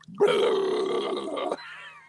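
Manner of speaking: a big loud splash sound, low and booming; loud mock splashing noise. Friend imitates Josh peeing into the toilet, loud splash noise. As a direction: imitate a strong stream hitting the toilet.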